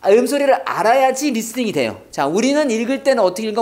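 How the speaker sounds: usual speaking voice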